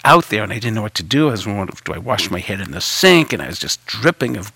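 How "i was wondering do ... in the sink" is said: ordinary talk, nothing marked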